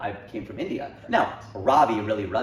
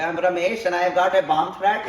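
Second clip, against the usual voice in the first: higher, louder mock Indian accent; Trevor Noah's Indian accent; 7 semitones higher, 7 dB louder than usual. Retelling a comedian's bomb threat bit, exaggerated Indian accent. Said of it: "he does an Indian accent".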